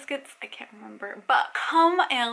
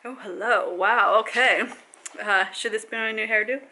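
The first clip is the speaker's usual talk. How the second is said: ordinary talk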